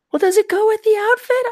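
high mock girlfriend voice. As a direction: play the girlfriend, high and sweet. Voicing girlfriend asking about outfit.

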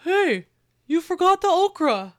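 talking with a mouthful; mouth full. Acted. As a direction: say it through a bite of steak. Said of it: "and with a mouthful".

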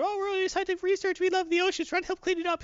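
lightly hammed-up innocent researchers; mock naive scientists. Scientists telling Namor they love the oceans, in a high put-on voice.

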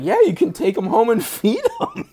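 can't keep a straight face quoting him; imitating Will Ferrell while cracking up; laughing quote. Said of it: "is like".

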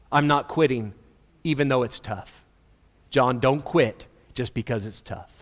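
low, gentle encouragement; Jesus. Acted. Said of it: "what Jesus is doing for John".

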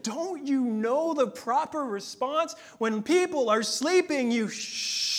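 a hint of a high, slow condescending little girl; lightly voiced smug child; condescending toddler. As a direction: talk down like a know-it-all toddler. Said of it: "she's like".

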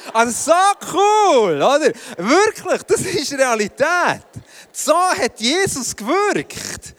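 high, loud and drawn out, marvelling; almost shouting with wonder, stretching each word; preacher overwhelmed with awe, slow and loud; excited. Real, not acted.

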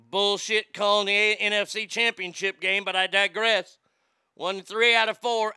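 ordinary talk, nothing marked

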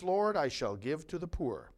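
ordinary talk, nothing marked

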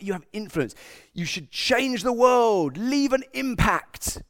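slow, grand rallying-cry voice; rousing slogan. Retold or imitated. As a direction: declaim it like a mock campaign slogan. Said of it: "You're always told".

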